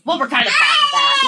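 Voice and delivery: high, loud laughing delivery; laughing punchline. Retold or imitated. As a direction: crack up on the punchline. Punchline quote laughing, crying.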